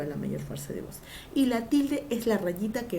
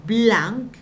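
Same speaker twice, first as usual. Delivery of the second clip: blanc loud and held on its own; stressed syllable; 8 dB louder than usual. Shown as a demonstration. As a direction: punch blanc as the accented beat. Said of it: "donde esté el acento".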